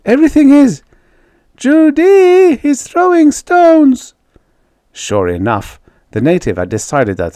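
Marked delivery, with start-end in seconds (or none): 0.0-0.8 panting. A narrator's reading. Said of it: "she panted". 1.6-4.1 high, loud, slow alarmed shout; alarmed cry. A narrator's reading. Alarmed call about stones.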